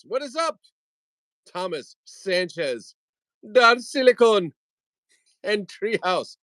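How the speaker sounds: very high, silly squeal; giddy falsetto. Pretend. Host greets viewers over rude toy joke, giddy falsetto. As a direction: keep it squeaky and hammed-up.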